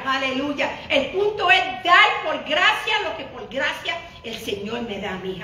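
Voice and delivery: usual speaking voice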